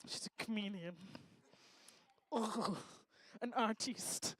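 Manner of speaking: soft mock posh disdain; quiet, put-on snooty voice; posh sister. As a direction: imitate a grand sibling's distaste. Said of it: "she's exceedingly posh".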